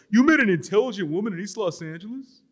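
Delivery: incredulous. A narrator's reading. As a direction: sound like you can't believe it. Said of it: "Asks, incredulous".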